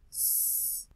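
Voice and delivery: unvoiced hissed "S"; hissing S sound. Shown as a demonstration. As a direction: hiss the "S" like a snake. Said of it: "de la consonante S". (sonido de S siseado)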